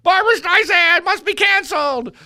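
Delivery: high, loud whiny wail; hammed-up bawling of an outraged mob; mock outrage. Host mimicking media virtue signalers, mock outrage. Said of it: "pushing their outrage button".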